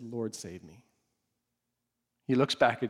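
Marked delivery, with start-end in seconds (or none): 0.0-0.8 very soft, low, desperate plea; hushed plea. Retold or imitated. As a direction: barely voice a cry for help while sinking. Peter sinking, cries for help.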